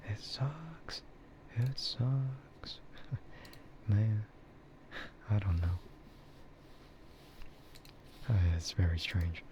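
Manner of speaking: normal talking voice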